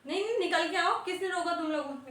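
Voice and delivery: high, loud call to come out; sharp order. Real, not acted. Teacher calling students to the front, loud order. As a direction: bark the order across the room.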